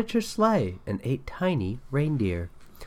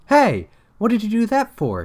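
high, louder indignant yelp; startled cry; 10 semitones higher, 4 dB louder than usual. Acted. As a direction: protest as if hit in the face. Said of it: "he cried".

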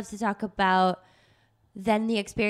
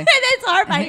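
laughing; 13 semitones higher, 9 dB louder than usual. Real, not acted. Laughing at the tampon story.

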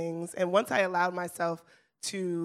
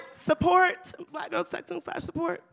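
high-pitched plug, loud at first then easing; playful hype; 11 semitones higher, 4 dB louder than usual. Real, not acted. Host plugs the website's donation tab, playful high-pitched hype. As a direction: sing the show's praises up high, starting big.

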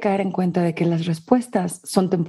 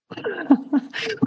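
high giggle; 11 semitones higher than usual. Real, not acted. Laughing at her own metaphor.

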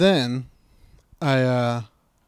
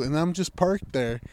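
ordinary talk

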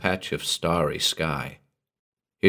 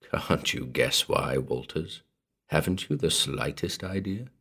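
soft taunting; 4 semitones lower, 8 dB quieter than usual. A narrator's reading. Morden hinting to Walters down the tube, softly taunting. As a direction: toy with the listener softly. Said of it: "Morden's laugh came softly down".

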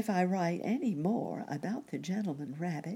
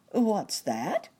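startled, louder question; surprised cry; 5 semitones higher, 5 dB louder than usual. Acted. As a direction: jump up in surprise. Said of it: "cried Uncle Wiggily".

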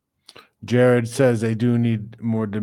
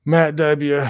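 ordinary talk, nothing marked

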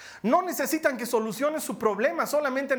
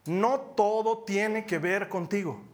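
slow, stressed "no todo tiene que ver contigo"; emphatic; much slower than usual. Real, not acted. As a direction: lean hard on "no" and drag the phrase. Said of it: "me gustaría que de veras lo anotes".

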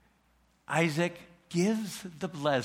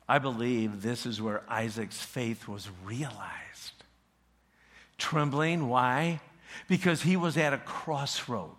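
ordinary talk

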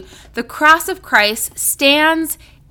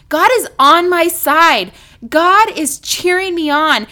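ordinary talk, nothing marked

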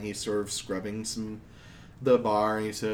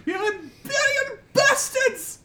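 mock outrage; 5 dB louder, slower than usual. GM echoing player, mock outrage.

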